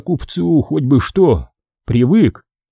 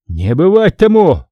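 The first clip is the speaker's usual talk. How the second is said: shout; 6 semitones higher, 4 dB louder than usual. A narrator's reading. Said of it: "закричал Васька".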